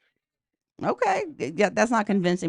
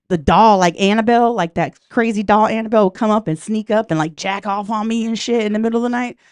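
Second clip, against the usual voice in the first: ordinary talk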